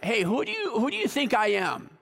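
light, high, offhand asking tone; mock casual question. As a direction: toss it off like a poll. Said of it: "He didn't say, like".

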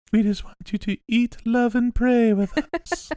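cult recruiter voice. Pretend. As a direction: coax them sweetly, high and loud. Mock cult recruiter after laugh.

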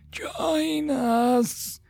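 high, drawn-out ghostly call; eerie invitation. Acted. Mind-controlled man beckoning soldiers, eerie. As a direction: stretch it out like a possessed soul.

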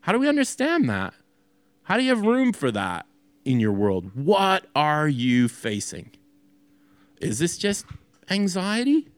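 normal talking voice

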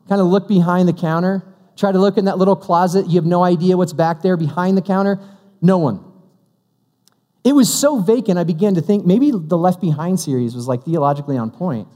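normal talking voice

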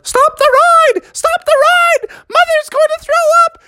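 put-on shrieky high children's yell; kids yelling. Kids begging for the ride to stop, high-pitched yelling. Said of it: "all the kids started yelling".

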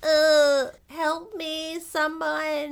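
high, loud plea for help; hammed-up stranded girl calling out; mock cry for help. Girl stuck in the storm calling for help, high and hammed-up.

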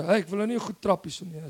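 ordinary talk

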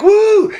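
high, loud "woo"; excited whoop. Acted. Ladies thrilled by their haircuts, high excited whoop. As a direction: whoop like a delighted salon customer.

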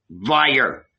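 sharp cry of accusation; accusing cry. A narrator's reading. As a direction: cut in with an accusing call. Heckles Paul's account.

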